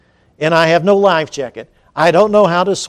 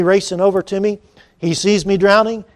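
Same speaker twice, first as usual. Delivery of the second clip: ordinary talk, nothing marked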